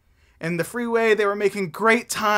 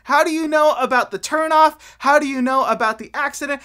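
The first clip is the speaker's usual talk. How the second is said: much higher, louder demanding questions; had enough; 8 semitones higher, 7 dB louder than usual. Acted. Tyson demanding answers from Tess, much higher and louder. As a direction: burst out in exasperation. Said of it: "he had finally had enough".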